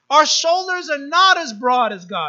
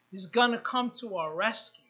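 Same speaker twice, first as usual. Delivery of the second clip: ordinary talk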